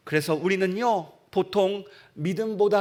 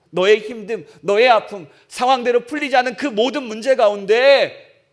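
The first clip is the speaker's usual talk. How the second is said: louder, building sermon push; fervent preaching; 2 semitones higher, 9 dB louder than usual. Acted. Preacher urging the tired congregation, fervent and loud. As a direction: press the point harder and harder.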